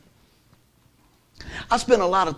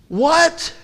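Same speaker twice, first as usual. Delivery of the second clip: ordinary talk, nothing marked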